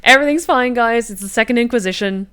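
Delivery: loud, high "everything's fine" panic; panicked fake calm. Real, not acted. Player panics on hearing of vampire hunters, loud, high and frantic. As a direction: shout that all is fine while panicking.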